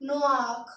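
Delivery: नोआक as French noir, with a throaty final r; French pronunciation of नोआक. Shown as a demonstration. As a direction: end नोआक on a rasped French r. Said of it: "French में बोलेंगे हम".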